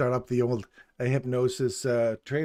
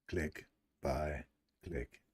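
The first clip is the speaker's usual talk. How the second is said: mock robot; 6 semitones lower, 7 dB quieter, much slower than usual. Imitating robot traders clicking.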